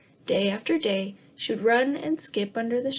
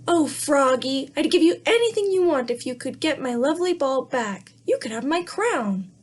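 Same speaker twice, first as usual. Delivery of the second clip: pleading princess; 4 semitones higher, 4 dB louder than usual. Acted. Princess begs the frog for her ball, high and pleading. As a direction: beg like a spoiled little princess.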